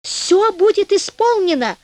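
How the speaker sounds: crying out. A narrator's reading. Devil promising anything from inside the sack, crying out. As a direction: agree in a high, urgent cry. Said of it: "закричал чёрт".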